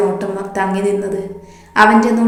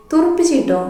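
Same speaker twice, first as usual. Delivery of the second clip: higher, disbelieving Thuruppu ചീട്ടോ; surprised question; 7 semitones higher than usual. A narrator's reading. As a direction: pitch jumps up in surprise. Girl puzzled by the plan. (അത്ഭുതത്തോടെയുള്ള ചോദ്യം)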